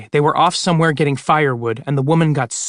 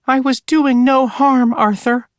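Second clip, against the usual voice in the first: much higher, hurt ghost-hog voice; 10 semitones higher than usual. Acted. Ghost of Anne as a hog scolds Arthur, high voice. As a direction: squeak it out like a wounded sow. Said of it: "have the hog speak in a high voice".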